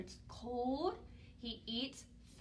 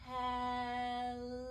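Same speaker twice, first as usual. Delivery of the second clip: one long, stretched-out, fading hello; as slow as possible. Shown as a demonstration. Storytime leader showing children the slowest hello, extremely drawn out. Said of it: "as slow as we can".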